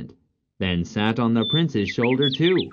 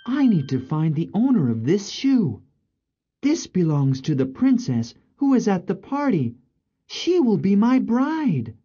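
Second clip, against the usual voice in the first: higher-pitched, resolute prince; determined prince; 9 semitones higher than usual. Acted. Prince announcing he seeks the shoe's owner, determined. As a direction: declare it like a royal suitor.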